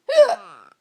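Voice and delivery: sharp loud gasp of shock. Real, not acted. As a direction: gasp at a bad word. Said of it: "No bad words".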